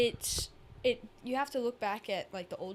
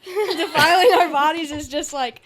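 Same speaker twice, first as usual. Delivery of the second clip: higher, much louder, laughing; laughing through words; 6 semitones higher, 15 dB louder than usual. Real, not acted. Resuming the point after being interrupted, laughing.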